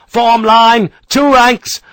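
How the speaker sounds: loud, high barked commands; shouted orders. Acted. Sharp orders his riflemen into line, shouting. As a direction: snap the men into formation. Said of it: "Sharp shouted at his desk".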